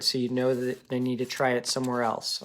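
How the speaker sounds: ordinary talk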